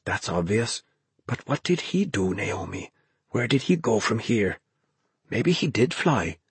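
hushed, puzzled questioning; whispering. A narrator's reading. Ben and Naomi puzzle over where he went, whispering. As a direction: whisper urgently, working out a puzzle. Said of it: "Ben whispered".

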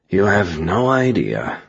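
slow, growled mutter at normal volume. A narrator's reading. Gabrielle answers the narrator about alien warlords, growling. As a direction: drag it out with a growl. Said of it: "Gabrielle muttered, growling".

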